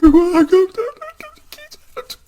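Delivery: high-pitched mock bawling, words dragged out; crying like a baby. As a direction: wail it like a blubbering baby. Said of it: "I was crying like a, like a baby".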